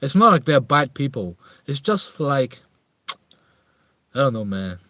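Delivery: ordinary talk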